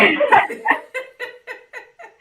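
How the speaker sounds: loud high laugh; burst of laughter. Real, not acted. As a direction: let a big laugh burst out.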